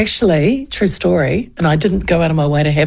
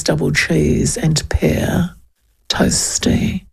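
unimpressed barista; 4 semitones lower, much slower than usual. Retold or imitated. Bored barista, quote.

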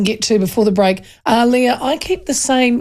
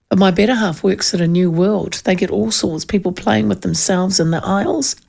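ordinary talk

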